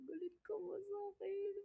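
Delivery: high, soft, slow whimper; mock tearful question. Imitating friend asking are you mad, whimpery. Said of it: "Parang ganun niya ako".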